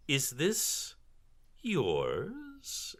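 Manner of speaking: high, drawn-out questioning; taunting villainess voice; haughty drow matron. Acted. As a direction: dangle the question with disdain. Matron sneers at the party.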